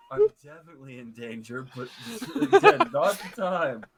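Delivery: loud, breaking up with laughter; real laughter bursting into the words; laughing. Real, not acted. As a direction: fight through the giggles to finish. Player cracks up while in character.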